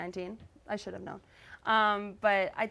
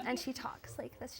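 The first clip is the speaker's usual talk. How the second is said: Katie Holmes; 3 semitones higher, 10 dB quieter than usual. Shown as a demonstration. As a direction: do a hushed celebrity impression. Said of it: "really good Katie Holmes impression".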